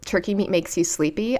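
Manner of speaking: normal talking voice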